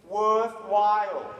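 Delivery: "worthwhile" stretched slow and loud; drawn-out emphasis. Real, not acted. Speaker telling the crowd they matter, stretching "worthwhile". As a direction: lean hard on "worthwhile". Stressing the key word to audience.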